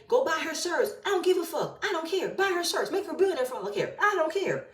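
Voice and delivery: high, dismissive mock voice; mocking Marceau. Vlogger mocking cast member Marceau, high sneering imitation. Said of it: "the way that you wanna say".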